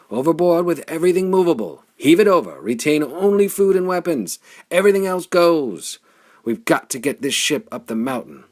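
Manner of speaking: loud, high, fast commands to the crew; rally the men with a bellow; shouted orders. Acted. Flame orders the crew to throw everything overboard, shouting. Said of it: "He shouted to the men".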